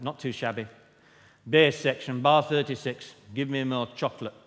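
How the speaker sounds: loud, blunt northern choirmaster calling out; northern chorus director. Retold or imitated. Choir director calling notes to the bass section, loud and blunt. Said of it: "he said".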